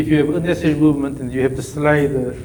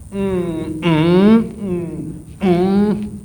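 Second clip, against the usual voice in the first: humming the rhythm; 4 semitones higher, much slower than usual. Shown as a demonstration. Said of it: "three triggers".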